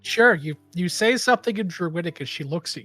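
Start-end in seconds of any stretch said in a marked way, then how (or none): none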